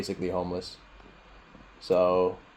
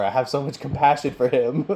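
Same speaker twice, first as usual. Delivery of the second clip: higher voice breaking into laughter; amused; 6 semitones higher, 4 dB louder than usual. Real, not acted. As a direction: say it on the edge of a real laugh. Laughing about angry boss, laugh follows.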